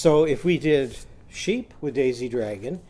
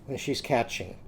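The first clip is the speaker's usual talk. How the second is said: ordinary talk, nothing marked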